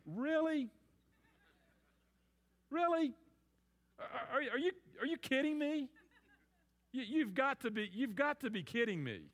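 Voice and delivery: high, scoffing disbelief with a laugh; incredulous God. Retold or imitated. God scoffing at the rulers who oppose him, incredulous. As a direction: ask it like you can't believe anyone would try. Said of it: "let me paraphrase or translate for you. God's saying".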